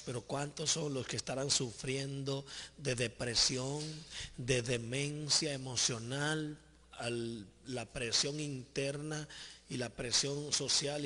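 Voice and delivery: solemn pleading prayer in measured phrases; earnest intercessory prayer. Real, not acted. Praying to the Lord for the sick and tormented, earnest and pleading. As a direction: plead steadily, item by item, as in prayer.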